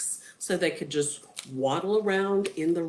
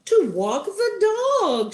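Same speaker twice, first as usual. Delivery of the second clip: high, slow, drawn-out wife; singsong wife handing over a chore; wizard's wife voice; 13 semitones higher, 7 dB louder, slower than usual. A narrator's reading. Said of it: "she said".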